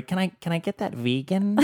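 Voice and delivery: high, soft mock diner; fussy customer. Imitating a diner wanting vegan, high soft mock voice. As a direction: put on a small, high customer voice.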